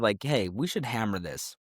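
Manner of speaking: ordinary talk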